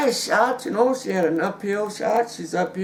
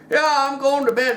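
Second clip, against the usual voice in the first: put-on drunk dad; 7 semitones higher, 4 dB louder than usual. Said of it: "Pops takes last slug".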